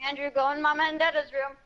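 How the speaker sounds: calling out. Real, not acted. Telling little brother which room, calling out.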